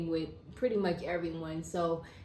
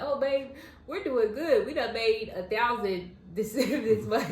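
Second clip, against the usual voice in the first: giddy bragging to husband; 4 semitones higher, 4 dB louder than usual. Retold or imitated. Wife quoting her own boast.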